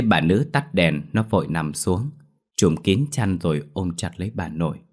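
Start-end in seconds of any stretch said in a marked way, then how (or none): none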